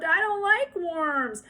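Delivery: put-on high, loud, slow sobbing child; high wailing little girl; mock sobbing. A narrator's reading. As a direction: wail it through pretend tears. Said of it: "she sobbed".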